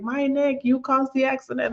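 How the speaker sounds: hammed-up victim clutching her neck; mocking the other driver's claim; mock complaining lady.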